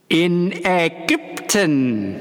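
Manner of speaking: high, slow gloating ghost call; boy calling triumphantly across the hall. A narrator's reading. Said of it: "rief ... triumphierend herüber".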